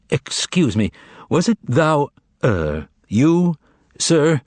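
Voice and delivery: unsure, feeling for the polite word; shaken. A narrator's reading. The shaken master addressing the cat, hesitant. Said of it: "Utterly shaken".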